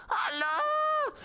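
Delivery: very high, screechy hello; TV caller. Acted. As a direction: play a caller squealing down the line. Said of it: "We have a caller".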